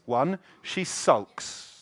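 ordinary delivery

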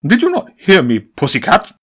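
loud, high, sudden menace; sharp pigeon snap. A narrator's reading. Pigeon boss turning on the Empress, loud and high. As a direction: drop the charm and strike.

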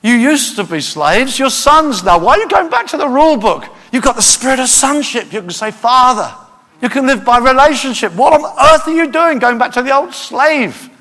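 high, loud indignant rebuke; loud exasperated plea; angry Paul. Acted. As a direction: scold them like an outraged apostle. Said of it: "Paul was so angry and upset".

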